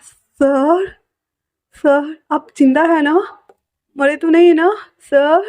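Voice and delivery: high, anxious pleading; frightened servant begging his master to wake; panicked plea. Acted. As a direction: shake him awake in a panic. Wing panics over fainted Wangji.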